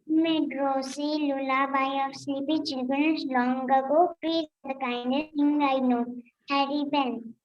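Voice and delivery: measured schoolroom recital of a memorised verse; poem recitation. Real, not acted. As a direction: recite steadily, line by line, for the teacher. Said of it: "have a poem recitation".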